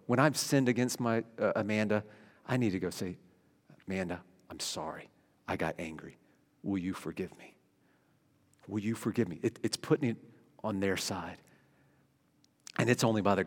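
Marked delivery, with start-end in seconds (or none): 3.9-9.4 soft, quiet asking for forgiveness; humble apology. Pretend. Husband apologizing to his wife after anger, hushed and humble. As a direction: drop to a hush as you say sorry.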